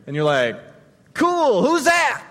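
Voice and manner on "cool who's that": high, loud, bright "who's that"; the newcomer asks who Jesus is, pitched high and loud; eager question. Pretend. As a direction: ask eagerly and a bit naive. Casual question to a stranger.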